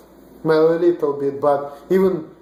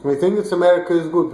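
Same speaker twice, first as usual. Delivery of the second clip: usual speaking voice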